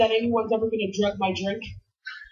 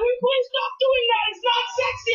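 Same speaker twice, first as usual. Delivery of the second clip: retold complaint in bed; 10 semitones higher than usual. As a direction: beg him to stop, half laughing. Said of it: "And then I'm like".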